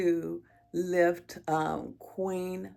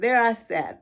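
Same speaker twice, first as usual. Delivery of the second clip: pushing the words out through rising emotion; getting emotional; 6 semitones higher, 7 dB louder than usual. Real, not acted. Mother recalling her sons at Costco, fighting back tears. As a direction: voice lifting and straining as tears come. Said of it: "I'm probably getting emotional right now".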